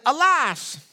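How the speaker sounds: high, hammed-up crone cry; cantankerous old woman. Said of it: "that's how she sounded".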